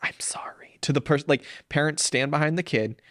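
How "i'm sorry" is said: whispered, embarrassed sorry; whispered apology. Retold or imitated. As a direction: breathe it out as an embarrassed parent. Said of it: "where parents go".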